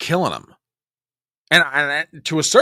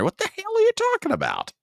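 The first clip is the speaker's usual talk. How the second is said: incredulous; 4 dB quieter than usual. Real, not acted. Host mocks clip in disbelief.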